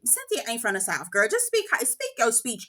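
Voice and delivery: normal talking voice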